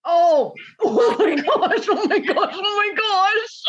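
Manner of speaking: laughing. Real, not acted. Reacting to emotional singing.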